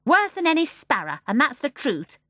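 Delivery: loud, high, indignant scolding; sharp retort. Acted. Mary Poppins scolds the starling in an indignant retort. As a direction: tell the bird off, loud and high.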